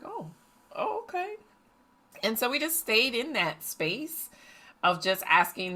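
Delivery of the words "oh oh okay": much higher, softer oh, okay; mother reacting to the daughter denying any part; mock surprise. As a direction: play it taken aback.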